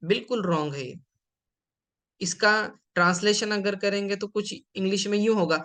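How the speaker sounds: ordinary talk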